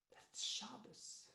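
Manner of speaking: hushed breathy whisper of awe. Acted. Girl realizing it's Shabbos, whispered in awe. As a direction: breathe it out like a secret.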